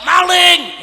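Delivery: loud, high sudden cry; shouted alarm. Acted. Homeowner shouting at an intruder, alarmed yell. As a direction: yell out like a homeowner spotting a thief. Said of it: "punya rumah teriak".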